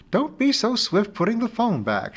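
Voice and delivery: a friend gently teasing her over the phone; friend Amy, fond teasing. Acted.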